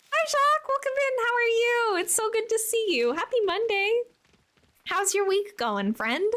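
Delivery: bright greeting. Real, not acted. Greeting a new chatter brightly.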